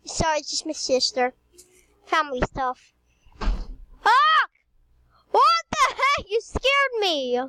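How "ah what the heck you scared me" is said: high startled shriek; startled scream. Real, not acted. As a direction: jump and yelp in fright. Startled by sister.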